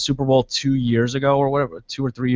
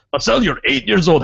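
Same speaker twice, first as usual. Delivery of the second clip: mock fan; 6 semitones higher, 3 dB louder than usual. Fan character berating son.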